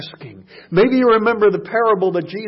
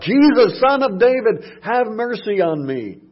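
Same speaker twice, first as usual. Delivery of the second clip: raised, pleading call; shouted plea; 6 semitones higher, 4 dB louder than usual. Retold or imitated. Blind beggar calling to Jesus, loud pleading shout. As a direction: cry out to Jesus for mercy. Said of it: "he began to shout".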